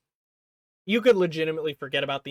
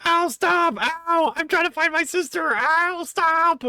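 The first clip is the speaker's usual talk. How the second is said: much higher, loud whimpering cries of pain; whiny beaten kid; 13 semitones higher, 4 dB louder, slower than usual. Retold or imitated. Vlogger voicing the boy crying out while the old man beats him, high and whimpering. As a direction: squeal like a kid being hit.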